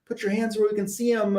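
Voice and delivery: high, urgent police calls; cops yelling. Retold or imitated. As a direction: bark orders at a fleeing thief. Cops shouting at fleeing Catwoman.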